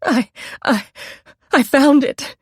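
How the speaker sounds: high, stammering terror, voice cracking; a frightened woman stammering under accusation; quivering, breaking voice. A narrator's reading. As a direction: stutter it out, voice breaking in fear. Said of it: "Her voice quivered, broke".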